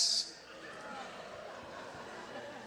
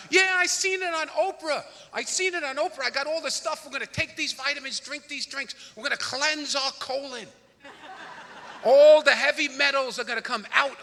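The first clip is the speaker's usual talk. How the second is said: higher, bubbly gushing wife impression; excited wife; 6 semitones higher, 6 dB louder than usual. Mimicking his wife gushing about an Oprah cleanse, higher and excited.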